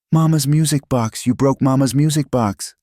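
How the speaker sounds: put-on high, crying child's wail; tearful child. A narrator's reading. The child to Eloise over the broken music box, in tears. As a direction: sob it out like a heartbroken little girl. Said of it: "Leah burst into tears".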